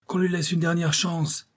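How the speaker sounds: high-pitched appeal from the crew; one sailor calling over the others; crying out. A narrator's reading. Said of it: "cria l'un des hommes".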